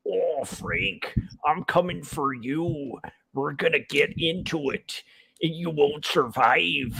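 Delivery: slow, menacing promo taunt; wrestler smack talk. Shown as a demonstration. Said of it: "wrestler smack talk sessions".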